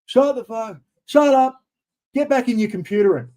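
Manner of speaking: hammed-up exasperated wife telling him off; the wife, fed up with him yelling at the TV, in a mock angry yell. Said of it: "And Roz goes".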